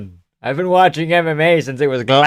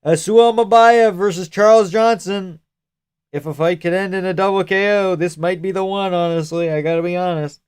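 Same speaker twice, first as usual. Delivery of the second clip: mock announcer; 6 semitones higher than usual. Announcer-style fight preview voice.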